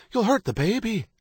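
high-pitched anxious warning; alarmed cry. A narrator's reading. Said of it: "Kate cried".

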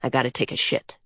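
low, casual grumble; offhand Coyote. A narrator's reading. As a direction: announce it matter-of-factly, low and softened. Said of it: "said Coyote".

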